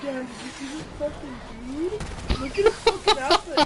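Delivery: loud imitation of an oblivious gossip; mock loud talker. Girlfriend mimics his remark about a stranger nearby, mock loud. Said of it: "He has a loud ass voice".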